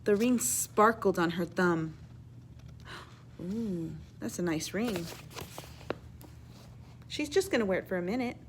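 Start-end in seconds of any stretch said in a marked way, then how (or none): 3.4-5.1 hushed admiring ooh. Acted. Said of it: "reader admires ring".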